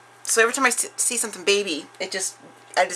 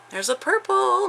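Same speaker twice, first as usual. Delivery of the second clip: delighted; 14 semitones higher, 5 dB louder than usual. Real, not acted. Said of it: "my favorite".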